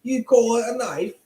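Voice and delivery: a failed Dundee that sounds British; Crocodile Dundee impression. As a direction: do Dundee and miss the accent. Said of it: "It's still British".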